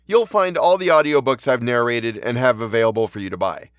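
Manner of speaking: usual speaking voice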